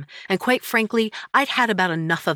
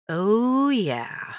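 knowing agreement; 5 semitones higher than usual. Acted.

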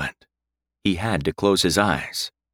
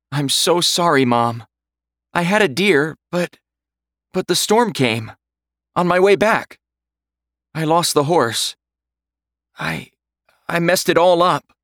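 higher, louder, distraught pleading; upset; 9 semitones higher, 4 dB louder than usual. A narrator's reading. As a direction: blurt it out, close to tears. Son confesses failure to mother.

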